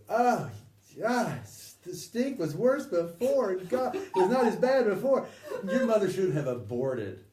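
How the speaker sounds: high, loud sneering taunts; mock insulting. Beloved taunts the armed men, high and loud.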